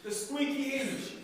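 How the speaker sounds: teacher pushing the class to say it with conviction; said like you mean it. Shown as a demonstration. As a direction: punch it out so they feel it. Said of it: "Say it like you mean it".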